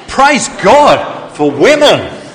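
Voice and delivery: high, slow, drawn-out shout. Shown as a demonstration. Preacher leading the men in praise, long loud high shout. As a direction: belt it out so the whole auditorium rings. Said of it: "speak up a bit louder".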